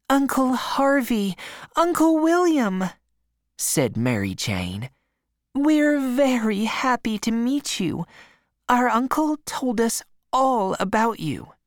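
put-on high, eager young girl's voice; Mary Jane welcoming her uncles in a high girl's voice. A narrator's reading. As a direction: lift way up and sound glad to meet them.

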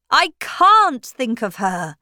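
crying out. A narrator's reading. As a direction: push the words out in despair. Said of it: "cried Stephen".

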